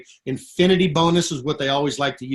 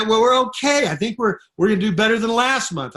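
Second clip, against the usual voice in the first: higher, timid mock voice of a struggling leader; mock meek voice; 5 semitones higher than usual. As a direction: make fun of their limp answer, a little laughing. Mocking struggling leaders again.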